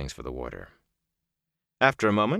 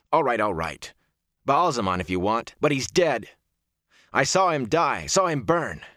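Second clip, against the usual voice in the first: rising, higher-pitched insistence; heated protest; 6 semitones higher, 4 dB louder than usual. A narrator's reading. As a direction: argue back, climbing in pitch. Rand arguing back, voice rising.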